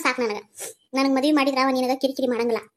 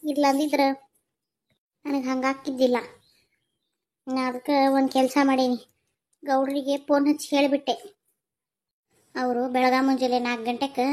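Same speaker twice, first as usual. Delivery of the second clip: ordinary delivery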